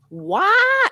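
a high, drawn-out Southern "Why?", imitating her coach; Southern accent. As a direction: mimic an old Southern vocal coach asking why. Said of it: "And it was a Southern accent".

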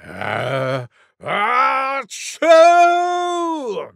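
gasping wind-up to a high, loud sneeze; huge fake sneeze. A narrator's reading. Wild Boar shows off her sneeze, huge mock sneeze. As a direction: build from low "ah" to explosive achoo. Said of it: "taking a deep breath, she went".

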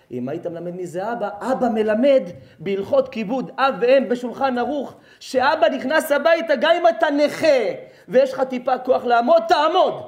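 loud, high impassioned sermon; full-force preaching. Real, not acted. Preacher demanding respect for fathers, impassioned shout.

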